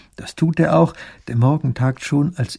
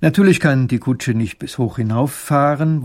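ordinary delivery, nothing marked

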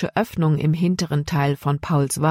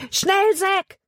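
urgent shout. A narrator's reading. Jonathan urges Zack to flee, high shout. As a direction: yell at Zack to run. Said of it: "schrie er".

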